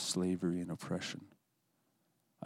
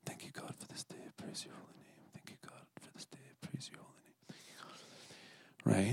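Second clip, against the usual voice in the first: whispered repeated prayer; very soft whisper, just on the lips; barely-voiced prayer; 11 dB quieter than usual. Shown as a demonstration. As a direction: murmur it under your breath, almost no voice. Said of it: "just barely on my lips".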